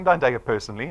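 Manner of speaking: usual speaking voice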